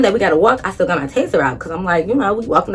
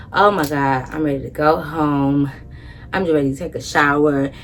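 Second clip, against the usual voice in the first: lowered, weary retelling; drained; 5 semitones lower than usual. Retold or imitated. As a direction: sound mildly worn out and done. Retold exhaustion after the ordeal.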